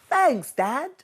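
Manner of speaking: high, grateful cub voice; young bear Douglas. A narrator's reading. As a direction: thank dad like a little bear. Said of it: "said Douglas".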